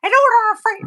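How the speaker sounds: high put-on drive-thru voice; squeaky high prank-order voice; silly customer voice. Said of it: "'Cause I'd always be like".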